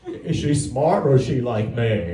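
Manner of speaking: a slow, simple worried father asking the doctor; Forrest Gump. Retold or imitated. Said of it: "I felt like Forrest Gump".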